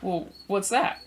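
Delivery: very high, baffled questioning; mock bewilderment, slowing on the question; puzzled surprise. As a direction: imitate someone hearing of this for the first time. Retold older man baffled by answer.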